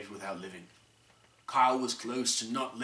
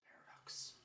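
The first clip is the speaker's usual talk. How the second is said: whisper; 20 dB quieter than usual. A narrator's reading. Whispered one-word aside.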